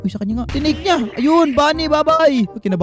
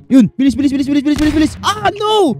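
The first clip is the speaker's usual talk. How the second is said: frantic urging; 6 semitones higher, 6 dB louder than usual. Real, not acted. Urging speed in tense round.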